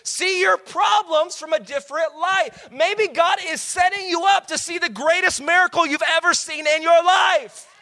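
high, loud preacher's shout; full-force preaching. Real, not acted. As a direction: shout it out to the congregation, stretching the last words.

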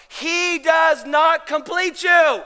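loud, high, drawn-out pulpit cry; full-force preaching. Real, not acted. Pastor telling ladies where identity comes from, preaching forcefully.

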